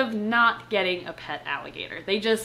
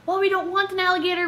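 higher, quick and grudging, as if to the owner; reluctant quote; 8 semitones higher, faster than usual. Retold or imitated. Telling the owner they never wanted an alligator, quick and high. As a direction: hurry through a reluctant no. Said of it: "we were like".

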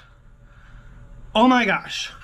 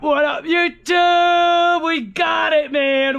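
high, loud, slow shouted greeting; hyped-up yell to the viewers; excited shout; 8 semitones higher, 4 dB louder, much slower than usual. Real, not acted. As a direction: stretch it out in triumph. Greeting viewers after the win.

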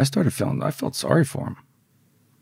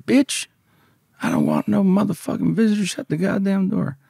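angry husband yelling; 11 semitones higher than usual. Retold or imitated. Husband yelling at his wife from inside the house, angry and higher. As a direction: bark it back, annoyed at being disturbed. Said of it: "And he's like".

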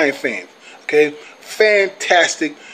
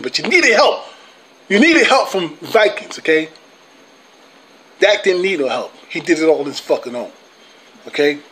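normal talking voice

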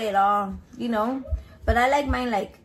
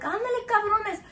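retold scolding; 7 semitones higher than usual. Shooing cats with hose.

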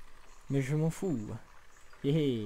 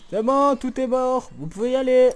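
much higher, louder call; shouting out; 10 semitones higher, 11 dB louder than usual. Real, not acted. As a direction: call it out triumphantly. Calling out to viewers, all dead. (crié fort et aigu)

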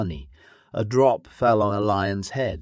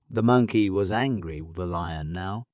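plain reading voice

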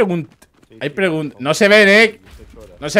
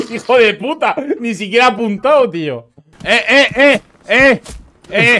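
raging gamer; 6 semitones higher than usual. Real, not acted. Laughing rage at in-game death.

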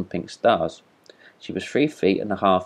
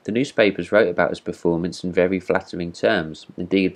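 usual speaking voice

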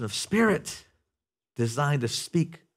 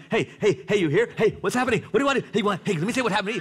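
high, eager, tumbling dog greeting, fast at the end; excited dog; 6 semitones higher, much faster than usual. Retold or imitated. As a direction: play the dog overjoyed that its owner is home. Dog greets owner at door.

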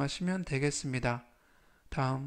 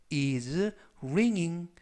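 slow model phrase; much slower than usual. Shown as a demonstration. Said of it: "꼬리에 아이엔지를 붙여서".

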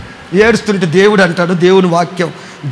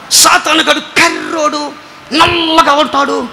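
slow, drawn-out imitation of the old pastor; old pastor's words; 6 semitones higher, slower than usual. Retelling the old pastor's sermon line, slow mock emphasis. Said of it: "అని అన్నప్పుడల్లా".

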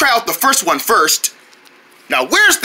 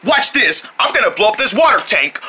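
usual speaking voice

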